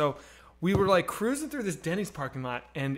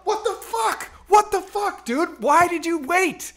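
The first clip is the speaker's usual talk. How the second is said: high-pitched, incredulous outburst; outraged yelling; 11 semitones higher, 3 dB louder, slower than usual. Retold or imitated. Punched teen demanding why Tucker waited, outraged. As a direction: reenact yelling at a friend in disbelief.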